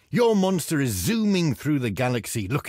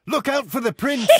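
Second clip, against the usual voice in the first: warning shout; 9 semitones higher, 4 dB louder than usual. Acted. Warning the player.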